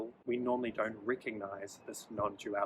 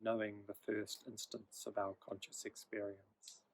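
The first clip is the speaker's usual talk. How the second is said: guided meditation. Acted. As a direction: ease the listener into stillness, gently.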